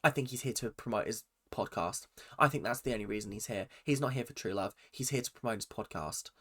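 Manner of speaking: low, quiet Australian accusation; mock-Australian grumbling verdict; Jordan. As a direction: imitate a suspicious Australian, voice dropped. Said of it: "let me get into my Australian accent".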